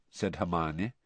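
ordinary delivery, nothing marked

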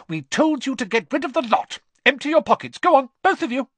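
furious, high and loud. A narrator's reading. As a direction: scold the twins in a fury. Mother still scolding twins.

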